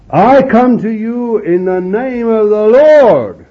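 solemn proclamation. Retold or imitated. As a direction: proclaim it slowly, every word weighed. David answers Goliath, proclaimed.

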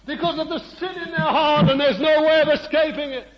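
high, loud ringing preaching; preacher in full flight, high and loud; full-force preaching. Real, not acted. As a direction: cry it out over the congregation.

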